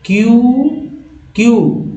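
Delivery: क्यू said as kyu, stressed with a high rise; teacher pronouncing letters. Shown as a demonstration. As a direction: call out क्यू brightly as the answer. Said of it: "सी यू को क्या पढ़ेंगे".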